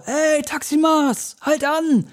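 hoher, langgezogener Zuruf von der Straße; hochgerufen. Retold or imitated. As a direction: ruf es hoch und gedehnt wie ein Fremder von weitem. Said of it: "Und dann schrie einer auch". (Passant ruft dem Taxifahrer hinterher, hoher gedehnter Ruf)

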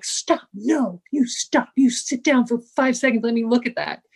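firm EMT friend. Retold or imitated. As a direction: order her to sit, no arguing. EMT friend ordering her down.

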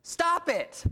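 high, loud shouted command. Retold or imitated. Therapist yelling at his patient, high shout. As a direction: yell "Stop it!" high and loud.

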